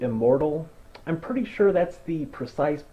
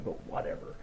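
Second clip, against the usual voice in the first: under-the-breath dismissal; muttered whatever; 13 dB quieter, faster than usual. Real, not acted. Narrator brushing off the plot hole, breathed out. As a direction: let but whatever fall away almost unvoiced.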